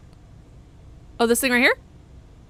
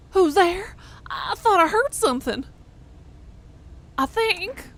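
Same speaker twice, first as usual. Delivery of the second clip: a raised, louder villager voice; NPC voice; 8 semitones higher, 4 dB louder, slower than usual. Acted. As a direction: voice the startled digger, pitched up. Streamer voicing an NPC in game.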